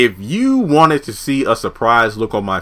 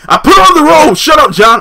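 high, loud shout; furious high shout at John; angry shout; 13 semitones higher, 7 dB louder than usual. Retold or imitated. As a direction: bark it at John. Pam snapping at John.

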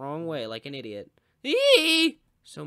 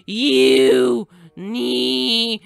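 high, loud outraged yelling; 8 semitones higher, 16 dB louder, slower than usual. Real, not acted. Gamer raging at a racer who knocked him back, loud yelling. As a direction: rage at the rival. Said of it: "I'm not actually that mad".

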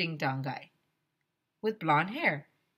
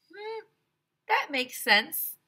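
put-on kid voice; 11 semitones higher, 4 dB louder, slower than usual. Kid voice agreeing.

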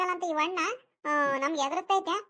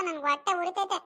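usual speaking voice

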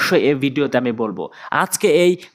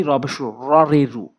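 ordinary talk

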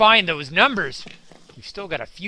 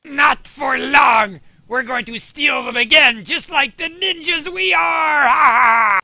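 loud, high gloating ninja villain; 7 semitones higher, 8 dB louder, slower than usual. Acted. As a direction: sneer it like a cartoon baddie. Ninja villains vow to steal again.

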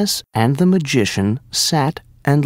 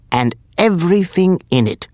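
mild cry of command, higher and quick; 6 semitones higher, faster than usual. A narrator's reading. Magician ordering the genie, lightly crying out. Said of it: "the magician cried".

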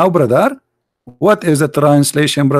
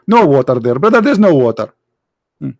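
ordinary talk

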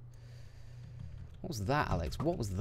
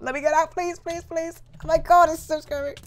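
high, loud panicked begging; panicked pleading; 6 dB louder than usual. Real, not acted. Trapped gamer pleading to escape, high and panicked. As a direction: beg to get out, terrified.

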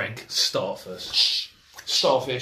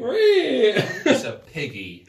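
slow, higher, laughing squeal; 11 semitones higher, 8 dB louder, much slower than usual. Real, not acted. Giggling at a friend's fart, slow and laughing.

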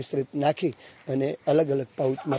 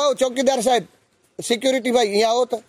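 raised voice calling a security guard over; 7 semitones higher than usual. Retold or imitated. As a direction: hail him from across the road.

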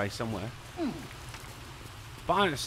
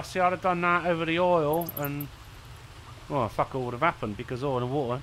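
ordinary delivery

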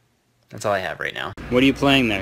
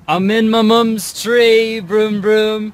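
put-on high, loud, slow playful kid; 8 semitones higher, 6 dB louder, slower than usual. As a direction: play a kid making car noises. Kid voice playing in tree.

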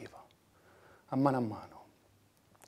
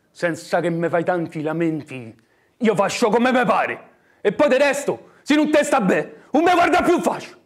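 higher, louder sneering laugh; mock him with a laugh; scornful bully; 6 semitones higher, 13 dB louder than usual. Brother scornfully rebuffs him.

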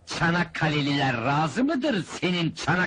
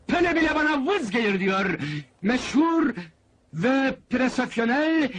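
high, proclaiming showman's pitch; high announcer voice, name drawn out at the end; barker's boast; 9 semitones higher than usual. Acted. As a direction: hype the famous goalkeeper to the crowd. Fairground barker boasts for goalkeeper.

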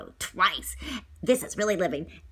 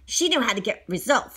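ordinary delivery

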